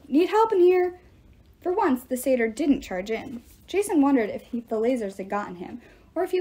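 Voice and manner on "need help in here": loud, high call; yelling for help. A narrator's reading. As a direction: shout it into the next room. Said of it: "Jason yelled".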